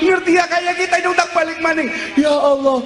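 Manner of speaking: high, wailing cry to God; acted wail of the damned; crying plea. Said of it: "Kowe wong pada nangis".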